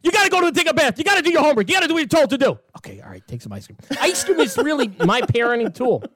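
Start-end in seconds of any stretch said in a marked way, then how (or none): 0.0-2.6 fake angry scolding. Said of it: "I'll do like". 2.8-3.8 put-on much softer, fast, lower aside to the kid; sudden soft aside. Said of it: "I'm not really angry".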